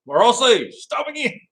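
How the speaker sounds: high-pitched, playful imitation of an Irish accent; mock Irish accent. Repeating Wade's line for the livestream, mock Irish accent. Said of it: "his Irish accent".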